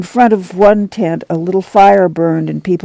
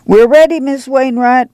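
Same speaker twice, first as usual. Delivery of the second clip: calling out; 8 semitones higher than usual. A narrator's reading. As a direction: call across to the neighbors. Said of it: "Ma called".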